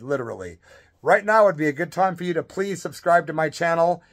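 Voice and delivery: ordinary talk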